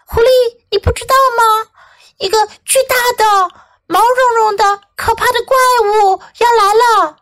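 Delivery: high, emphatic storybook rabbit; alarmed rabbit. Acted. Rabbit warns fox of the monster, high and alarmed. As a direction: build alarm word by word.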